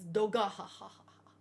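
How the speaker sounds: booming villain laugh, low; mock giant laughing; Loki's laugh. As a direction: imitate a giant's big laugh. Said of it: "his laugh is one of the more memorable ones".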